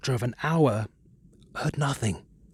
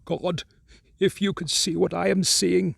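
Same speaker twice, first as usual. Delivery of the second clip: shaky frightened call; 6 semitones higher than usual. Acted. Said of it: "shaky whisper more portentous than the loudest shriek".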